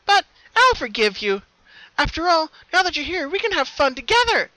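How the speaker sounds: forgiving and eager to play; bubbly party voice; happy bouncy Pinkie. A narrator's reading. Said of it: "she bounced happily".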